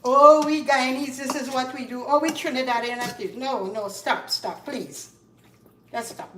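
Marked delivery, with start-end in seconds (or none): 0.0-3.3 mock complaining. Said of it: "like, complaining".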